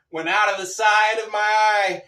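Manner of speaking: ordinary talk, nothing marked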